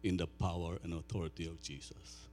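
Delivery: soft, low, reverent prayer voice. Acted. Pastor praying softly over his people before preaching. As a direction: pray quietly over the congregation.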